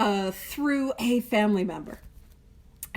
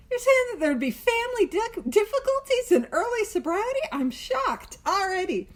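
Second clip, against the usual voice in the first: mock shock; 8 semitones higher than usual. Mock surprise at obvious difficulty.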